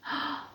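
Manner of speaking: sharp storyteller gasp. Acted. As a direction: gasp in mock shock at the empty plate. Narrator gasps at empty plate.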